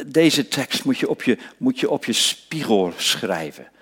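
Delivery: normal talking voice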